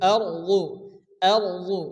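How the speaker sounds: আরদু with a full, thick ra; heavy ra. Shown as a demonstration. Teacher shows students the heavy ra in আরদু. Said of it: "রকে পুর অর্থাৎ মোটা করে উচ্চারণ".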